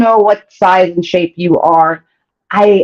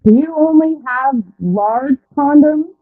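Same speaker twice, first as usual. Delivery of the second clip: higher, slow, sheepish question; awkward quote; 6 semitones higher, slower than usual. Retold or imitated. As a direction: voice his embarrassed hesitation. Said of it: "it is so awkward that he said".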